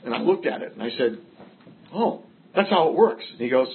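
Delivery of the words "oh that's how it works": higher, louder "oh" of discovery; mock sudden realization. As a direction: act out the lightbulb moment. Realizing the sponsor's point.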